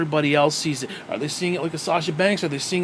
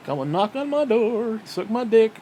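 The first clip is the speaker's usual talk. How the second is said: drawn-out, higher-pitched mock quote; mocking imitation; 5 semitones higher, slower than usual. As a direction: parody the line slowly and scornfully. Mockingly repeats the scripted line.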